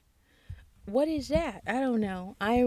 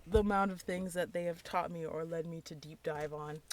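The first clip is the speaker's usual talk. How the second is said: usual speaking voice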